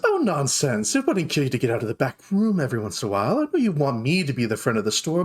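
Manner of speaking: rapid, chiding big sister; fast scolding. Acted. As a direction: rattle it off, scolding. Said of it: "She goes".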